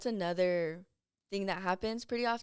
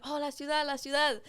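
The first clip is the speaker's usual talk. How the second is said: higher-pitched retold alert; imitated warning call; 6 semitones higher than usual. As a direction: pass the warning down the line. Vendors passing on the warning.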